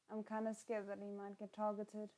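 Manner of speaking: ordinary talk, nothing marked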